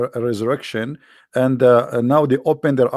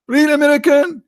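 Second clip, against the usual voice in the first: much higher and louder mock; mocking real American; 13 semitones higher, 5 dB louder than usual. Host ridicules sheikh's phrase, much higher and louder. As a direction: shout the phrase high in ridicule.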